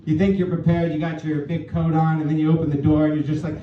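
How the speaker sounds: usual speaking voice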